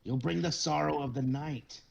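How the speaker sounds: high, urgent warning; angry old man. Acted. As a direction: warn him he'll get them killed. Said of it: "And he goes".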